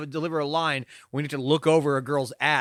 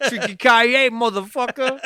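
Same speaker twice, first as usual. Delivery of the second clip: loud, high mock-heroic yell; action catchphrase; 8 semitones higher, 7 dB louder, slower than usual. Pitching a better movie one-liner, mock-heroic shout.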